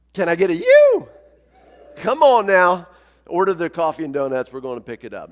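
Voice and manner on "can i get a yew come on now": loud, high surfer whoop to the crowd; big hollered burst of stoke; rousing call-out. Real, not acted. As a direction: fire up the congregation for a cheer. Calls on congregation for a surf cheer.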